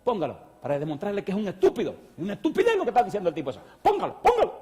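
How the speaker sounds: rising to a very high, loud and fast push; excited insistence. Retold or imitated. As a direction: urge him to play it.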